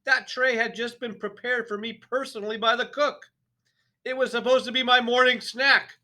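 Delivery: enraged headmistress; furious tirade. A narrator's reading. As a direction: pound every accusation home. Trunchbull's tirade about the cake.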